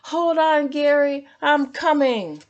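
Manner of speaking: high, loud rushing call; urgent call. Acted. SpongeBob running to crashed Gary, urgent loud call. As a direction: hurry to his rescue.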